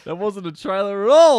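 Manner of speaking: high, loud laughing protest; laughing loudly. Real, not acted. Laughing at the bad trailer.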